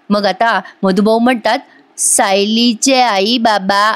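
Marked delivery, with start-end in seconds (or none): 2.0-3.9 very slow, stretched words; slow motion. Retold or imitated. Recapping the serial, echoing the slow-motion line. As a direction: drag each word out like slow motion. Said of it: "असं जरा slow मोशनमध्ये ऐकवलं".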